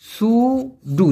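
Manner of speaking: সুডু drawn out very slowly, syllable by syllable; slow pronunciation. Shown as a demonstration. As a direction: stretch সুডু out so the learner hears each sound. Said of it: "Malaysian ভাষায় বলা হয় সুডু".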